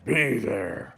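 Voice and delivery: booming announcer hype; monster truck ad. Retold or imitated. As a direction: yell it like a truck rally promo. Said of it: "favorite little commercials".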